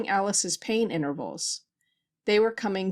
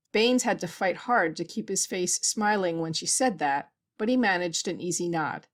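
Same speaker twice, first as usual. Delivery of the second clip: ordinary delivery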